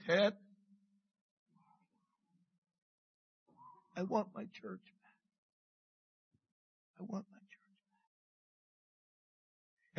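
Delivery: God speaking quietly, longing for his church; hushed divine voice. Retold or imitated. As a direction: let it fade almost to nothing. God's words, soft, repeated.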